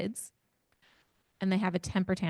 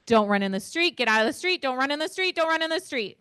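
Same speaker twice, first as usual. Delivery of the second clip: louder, climbing higher with each repeat; urgent warning; 6 semitones higher, 3 dB louder than usual. Shown as a demonstration. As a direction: escalate the warning each time. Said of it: "close your eyes".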